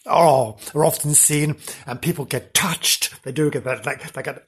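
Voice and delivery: normal talking voice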